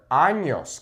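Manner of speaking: "años" with a ny sound in the middle; careful Spanish ñ in "años"; correct ñ pronunciation. Shown as a demonstration. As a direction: say "años" as AHN-yos. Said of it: "Be careful with the pronunciation".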